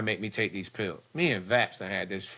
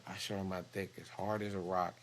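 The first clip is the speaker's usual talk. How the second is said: ordinary talk, nothing marked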